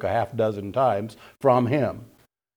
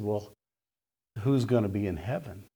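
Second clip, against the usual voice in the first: low, soft, subdued question; quiet; 3 semitones lower, 5 dB quieter than usual. Retold or imitated. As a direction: ask it softly after the shock has sunk in. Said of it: "then it gets real quiet".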